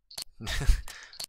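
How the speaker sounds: quick unvoiced laugh; short amused laugh at the names; breathy laugh. Real, not acted. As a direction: laugh under your breath.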